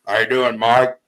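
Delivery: booming, over-friendly hello; loud hearty greeting. Retold or imitated. Frank glad-hands Mike Patty outside court, a loud hearty hello. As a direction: hail him like an old buddy.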